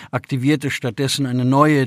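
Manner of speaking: usual speaking voice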